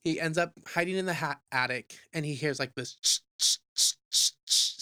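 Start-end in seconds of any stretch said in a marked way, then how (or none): 3.0-4.8 repeated sharp unvoiced "ch" noises; rhythmic mouth-made sawing sound effect; imitated cutting sound. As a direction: imitate repeated slicing with short hisses.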